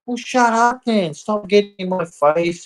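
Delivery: high-pitched acted telling-off; exasperated retort. Man answers the woman getting in his face, exasperated retort. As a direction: snap back in a high, fed-up voice.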